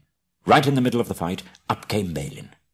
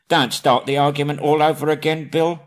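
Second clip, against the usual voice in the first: higher, louder cross troll voice; irritated troll; 7 semitones higher, 5 dB louder than usual. Acted. Bert scolding William, higher and louder. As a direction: snap at your fellow troll.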